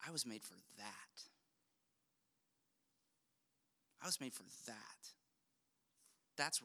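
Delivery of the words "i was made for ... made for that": hushed longing. Retold or imitated. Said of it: "we say".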